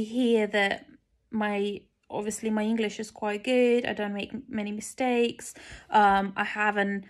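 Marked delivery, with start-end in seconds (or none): none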